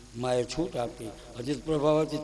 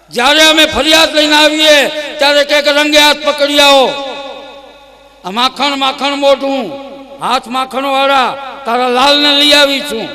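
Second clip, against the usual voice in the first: loud, much higher yelling woman; shouting complaint; 11 semitones higher, 15 dB louder than usual. Acted. Prabhavati shouts at Yashoda, furious complaint. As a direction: storm in shouting an accusation. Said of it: "Prabhavati બૂમ પાડે".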